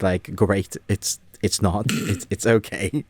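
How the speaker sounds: ordinary talk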